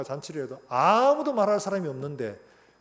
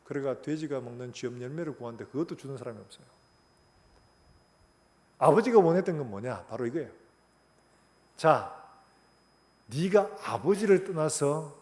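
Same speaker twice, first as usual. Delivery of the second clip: ordinary delivery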